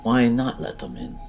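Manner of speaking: plain reading voice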